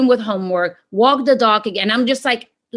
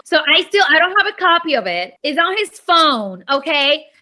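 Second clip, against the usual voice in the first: ordinary delivery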